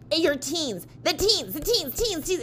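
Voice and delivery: high-pitched imitation of a yelling customer; mock yelling. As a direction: yelp it over and over, mockingly. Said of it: "she's yelling teens at me".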